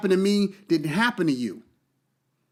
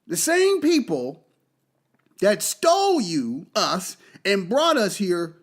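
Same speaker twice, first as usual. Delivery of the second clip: normal talking voice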